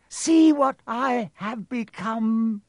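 high, loud, slow villain; Voldemort's voice. A narrator's reading. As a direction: proclaim it in an eerie high voice. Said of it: "the face said".

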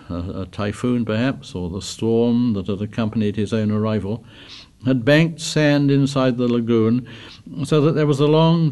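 usual speaking voice

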